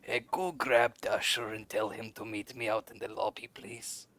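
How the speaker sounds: a soft aside to a tiny creature, fading to almost nothing; speaking down softly. Acted. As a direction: quietly address the little one on your shoulder. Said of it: "speaking down to, uh, little Spitz".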